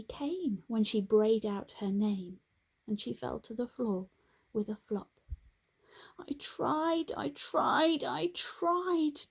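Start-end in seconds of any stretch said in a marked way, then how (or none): 6.2-9.3 high, pleading repeats of tried; crying out. A narrator's reading. Donkey crying that she tried. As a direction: cry it out, giving up. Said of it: "Dilly cried".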